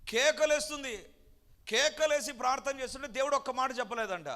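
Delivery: preacher stirring the congregation, high and loud; preaching climax. Real, not acted. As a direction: press the point with full force. Preacher rising to peak intensity.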